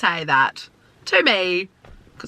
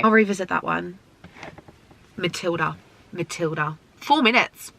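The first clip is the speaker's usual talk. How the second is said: usual speaking voice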